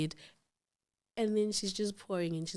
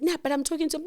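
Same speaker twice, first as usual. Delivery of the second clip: higher-pitched retold protest; arguing protest; 8 semitones higher, much faster than usual. Wife arguing over the dropped call, protesting. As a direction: push back in a rising voice. Acted quarrel with husband.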